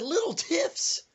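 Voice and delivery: high squeaky disbelief; shrill-free high disbelieving echo; incredulous. Retold or imitated. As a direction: echo his words back in shock. Incredulous retort to friend.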